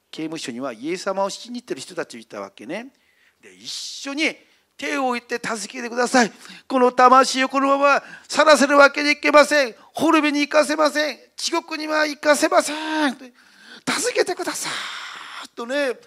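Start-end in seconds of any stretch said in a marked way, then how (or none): 5.4-15.5 crying out in prayer, much louder and higher; loud tearful prayer. Retold or imitated. Believers praying over the prisoner, a loud tearful plea. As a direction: plead with all your voice, near tears. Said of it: "もう声を上げて涙でね".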